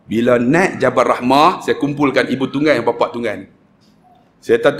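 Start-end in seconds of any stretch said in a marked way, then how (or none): none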